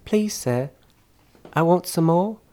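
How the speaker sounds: put-on high, halting English child's voice; small high British boy, pausing; pleading orphan boy. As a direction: beg timidly as a little English orphan. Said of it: "and Oliver goes".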